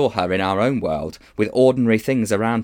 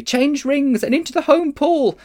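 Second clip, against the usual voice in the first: urgent; 5 dB louder than usual. Acted. Polly urging.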